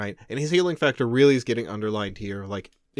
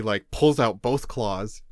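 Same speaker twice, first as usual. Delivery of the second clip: ordinary talk, nothing marked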